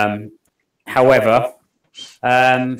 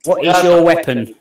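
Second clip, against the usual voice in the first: louder, firm repeated question; insistent; 5 semitones higher, 6 dB louder than usual. Real, not acted. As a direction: demand an answer, losing patience. Asks a third time, ignored.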